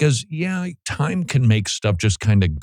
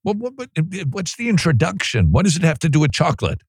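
incredulous; 7 semitones higher, faster than usual. Real, not acted. Host incredulous at chocolate sermon.

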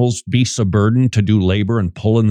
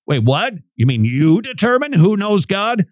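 1 incredulous listener; 7 semitones higher than usual. Retold or imitated. Imagined listener objects.

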